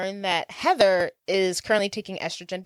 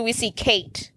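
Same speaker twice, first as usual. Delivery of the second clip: usual speaking voice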